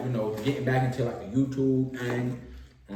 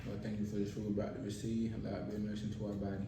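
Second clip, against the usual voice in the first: quick quiet grace; 3 semitones lower, 11 dB quieter, much faster than usual. Real, not acted. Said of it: "we gotta say our grace".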